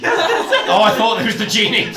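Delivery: loud, high laughing words. Real, not acted. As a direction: laugh out loud mid-sentence. Player bursts out laughing.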